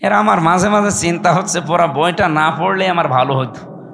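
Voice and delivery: usual speaking voice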